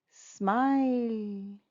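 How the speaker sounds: slow, stretched single word; drawn out. Shown as a demonstration. Teacher answering for the children, slowly drawn out. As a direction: hold the vowel long.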